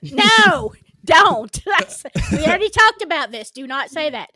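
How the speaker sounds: loud, high, laughing objection; loud protest. Real, not acted. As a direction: shout it down, half laughing. Objecting loudly to a phrase.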